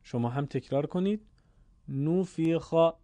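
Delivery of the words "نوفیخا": نوفیخا with a rounded Arabic او, slowly; Arabic vowel demo. Shown as a demonstration. Teacher modelling نوفیخا for students to repeat. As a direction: say نوفیخا slowly, damma leaning to او. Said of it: "با لهجهٔ عربی، ضمه میل به او".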